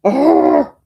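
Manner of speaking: sudden loud high cry of exasperation; a real yelp as it snags again; exasperated cry. Real, not acted. Buttons hooked wool again.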